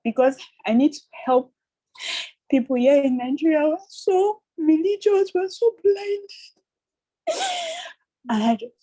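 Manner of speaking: high, anguished crying plea; tearful. Real, not acted. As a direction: plead through tears, pitch climbing as you go. Crying, pleading about her own people.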